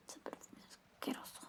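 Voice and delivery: very soft disgusted whisper. Acted. Whispering a jealous jab about the girlfriend's perfume. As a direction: hiss it under the breath.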